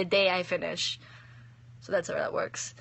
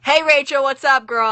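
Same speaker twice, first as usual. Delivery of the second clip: excited greeting; 5 semitones higher, 8 dB louder than usual. Real, not acted. Greeting friend.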